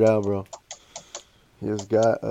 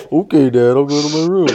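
loud, higher-pitched exaggerated compliance; hammed-up fake obedience; mocking kid; 5 semitones higher, 9 dB louder than usual. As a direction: parrot Dad in a cheeky taunt. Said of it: "Just mocking people".

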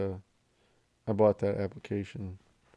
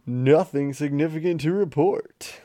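higher, louder show host announcing; show intro announcer; 6 semitones higher, 7 dB louder, slower than usual. Pretend. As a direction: announce the podcast title.

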